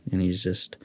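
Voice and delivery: ordinary talk, nothing marked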